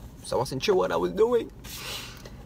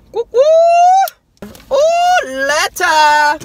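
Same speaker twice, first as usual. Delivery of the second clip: loud, much higher whoops; excited whooping; 10 dB louder, much slower than usual. Real, not acted. Opening his Australia package on camera, whooping loudly. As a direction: whoop with delight as the box opens.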